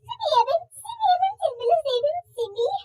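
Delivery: high tearful pleading; crying plea. Acted. Mother crying at the girl to let go. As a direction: beg her through tears to let go.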